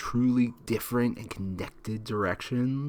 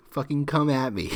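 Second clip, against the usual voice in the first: ordinary delivery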